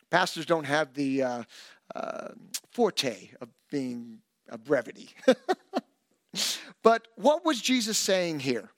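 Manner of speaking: ordinary talk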